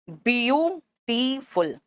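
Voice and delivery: "Beautiful" drawn out slowly as bi-yu-ti-ful; broken into syllables. Shown as a demonstration. Teacher splitting a word for students, slow syllable by syllable. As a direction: leave clear gaps between the syllables of "beautiful". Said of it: "अब इसको शब्द के खंड में तोड़ोगे".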